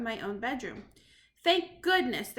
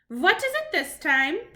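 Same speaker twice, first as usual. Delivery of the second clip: loud, slow witch shout; 5 semitones higher, 7 dB louder, slower than usual. Acted. The witch yells at the knock on her door. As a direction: snap at the knock, slowly. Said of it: "shouted the Grand High Witch".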